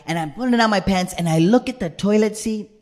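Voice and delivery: ordinary talk, nothing marked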